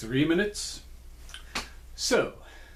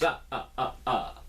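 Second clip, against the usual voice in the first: vampire count laugh. Pretend. Count's laugh after brand name.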